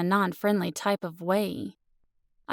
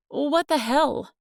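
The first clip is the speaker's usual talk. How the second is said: startled outburst; 7 semitones higher, 4 dB louder, slower than usual. Acted. Startled by a sudden kiss.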